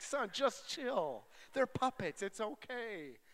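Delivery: high, loud retold plea to a child; exasperated dad. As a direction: call out in mock exasperation. Retold dad calming his yelling son.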